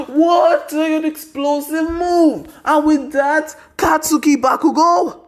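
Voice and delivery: high, slow hammed-up announcer call; crowning the winner, high announcer yell. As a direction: belt it like a ringside announcer. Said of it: "Prison Mike is like".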